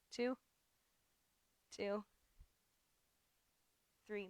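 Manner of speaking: counting through muscle shake; shaking with effort. Real, not acted. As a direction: voice trembling from strain. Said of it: "My legs are shaking".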